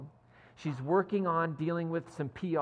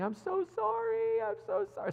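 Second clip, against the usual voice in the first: high, wailing imitation of crying; hammed-up tearful apology; mock bawling. Keeley confessing to Rebecca, imitated bawling. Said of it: "bawling her eyes out".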